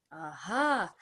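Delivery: ordinary delivery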